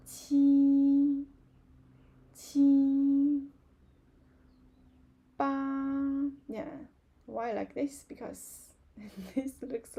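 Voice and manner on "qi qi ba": each number called out loud with its tone; teacher counts aloud for the class to join; counting demo. Shown as a demonstration.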